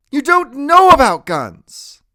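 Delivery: very high, slow, loud; shouted rebuke. A narrator's reading. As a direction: rebuke him at a yell. Grandmother shouts in the quarrel.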